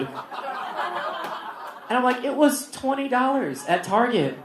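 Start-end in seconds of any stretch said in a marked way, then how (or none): 2.2-4.4 high, drawn-out plea; exasperated high-pitched defence; mock protest. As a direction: plead it up high, slowly. Teacher protesting about his bottle.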